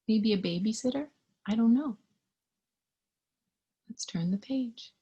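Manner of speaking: plain reading voice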